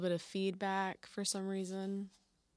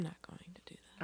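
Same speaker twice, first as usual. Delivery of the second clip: whispering; 11 dB quieter, much faster than usual. Real, not acted. Whispered aside.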